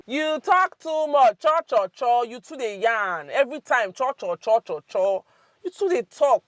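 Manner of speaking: loud, high, exasperated nagging; mock scolding. Teacher acts out telling a chatterbox off, mock exasperation. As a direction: mimic endless chatter while telling someone off.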